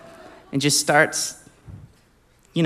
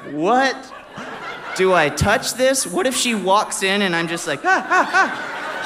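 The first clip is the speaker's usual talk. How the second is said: high-pitched, hammed-up bewilderment; mock alarm; 9 semitones higher than usual. Man imagines being caught holding it, in high mock alarm. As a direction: play it thrown, climbing to silly high cries. Acts out alarm at found object.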